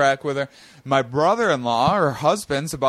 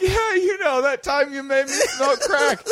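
mock sister voice; 10 semitones higher than usual. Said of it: "She's like".

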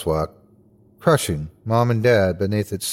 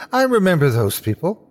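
higher, surer realisation; excited recognition; 6 semitones higher than usual. A narrator's reading. Boy realises he knows the couple, high and excited. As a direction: lift the pitch as recognition takes hold.

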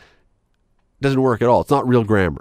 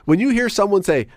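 normal talking voice

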